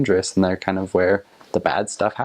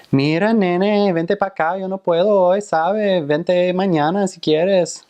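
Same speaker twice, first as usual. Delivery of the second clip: Puerto Rican sing-song; 10 semitones higher, 3 dB louder than usual. Shown as a demonstration. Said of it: "I could demonstrate some Puerto Rican sing-songy".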